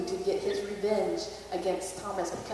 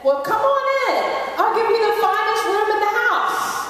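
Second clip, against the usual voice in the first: much higher, louder, gushing welcome; sudden welcome; 12 semitones higher, 5 dB louder than usual. Acted. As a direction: flip to an overeager host. Innkeeper suddenly welcomes them.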